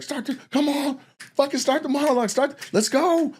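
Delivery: high, strained backstage plea; acting out an urgent push to get moving; mock urgent urging. Imitating backstage writers urging the host on, high and urgent.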